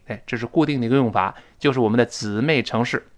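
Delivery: ordinary delivery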